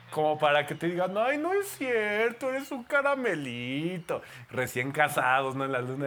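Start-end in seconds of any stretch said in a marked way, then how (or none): 1.2-4.2 higher, slow and sugary; mock-adoring spouse praising her husband; sweet partner voice.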